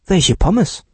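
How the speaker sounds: put-on a girl faking astonishment to dodge paying; feigned surprise. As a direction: play dumb, pretend you're amazed. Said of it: "tat Nora erstaunt".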